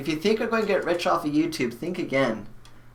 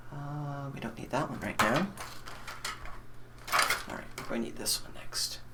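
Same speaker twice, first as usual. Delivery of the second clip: whispered under the breath, much softer; 11 dB quieter than usual. Real, not acted. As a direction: whisper it to yourself while working. Muttering while wiring the PC.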